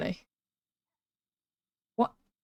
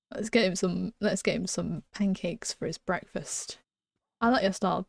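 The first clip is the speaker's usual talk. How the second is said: ordinary talk, nothing marked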